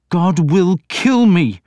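high, loud, slow bitter cry; bitter despair. A narrator's reading. Alfred to the priest, a bitter slow cry. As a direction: spit out his despair. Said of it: "Alfred said bitterly".

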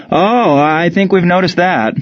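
mock him, stretching it; sarcastic. A narrator's reading. Ray mocking Mueller's nationalism, slow sarcastic. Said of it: "answered sarcastically".

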